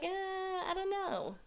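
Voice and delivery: high, slow mock hesitation; imitating someone reluctant to agree; hesitant. Acting out reluctant tribes' answer.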